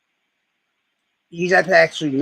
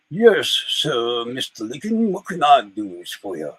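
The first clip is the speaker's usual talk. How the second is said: Robert E. Lee; 5 semitones lower, slower than usual. Retold or imitated. General reporting to Lincoln, Southern gentleman voice. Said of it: "So Robert E. Lee comes in".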